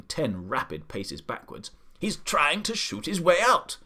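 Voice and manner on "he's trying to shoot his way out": alarmed shouting. A narrator's reading. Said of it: "the Duke shouted".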